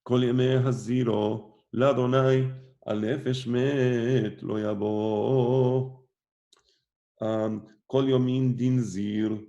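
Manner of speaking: slow, chanted scripture reading; cantillated verse reading. Acted. Teacher chants the Pasuk and Targum for the class, slow. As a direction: intone the verse like Torah reading.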